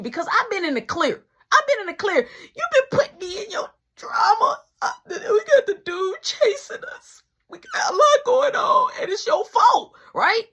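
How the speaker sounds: high, loud complaining wail; mock whiny outburst. Blaming friend at top of voice. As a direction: whine and blame him loudly. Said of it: "arguing, crying out".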